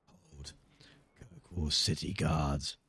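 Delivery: low, soft, slow guard; gruff guard voice. Acted. Streamer correcting the guard's voice, low and slow. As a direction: drop the word Hold low and flat, then drawl out Kirkwall City Guards. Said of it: "That's how he talks".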